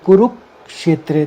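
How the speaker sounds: ordinary talk, nothing marked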